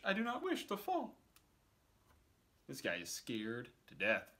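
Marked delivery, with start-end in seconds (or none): 0.0-1.2 much higher whimpering plea; frightened fish. Acted. Fish begs the cat not to drop him, high. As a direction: plead not to be dropped. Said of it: "said the fish".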